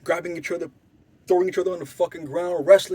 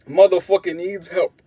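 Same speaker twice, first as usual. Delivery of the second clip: normal talking voice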